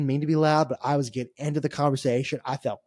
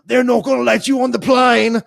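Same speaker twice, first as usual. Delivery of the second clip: put-on loud, higher, sing-song drunk Geordie jeer; mock Geordie singsong taunt; 8 semitones higher, 7 dB louder than usual. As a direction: chant it like a drunk Newcastle fan. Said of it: "He's like".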